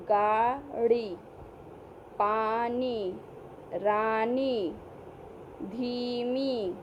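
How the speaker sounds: each word drawn out and clear; patient classroom word list; slow teacher reading. Shown as a demonstration. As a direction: read the words slowly for small children.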